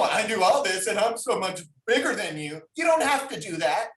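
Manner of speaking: normal talking voice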